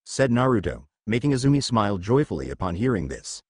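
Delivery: ordinary delivery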